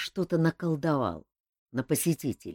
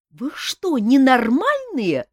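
indignant; 11 semitones higher, 9 dB louder, slower than usual. A narrator's reading. Nymph scolds tavern crowd.

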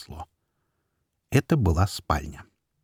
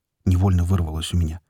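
plain reading voice

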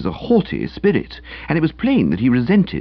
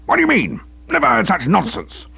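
pompous sputtering colonel; 11 semitones higher than usual. Acted. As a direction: scoff at such nonsense. Uncle scoffs high.

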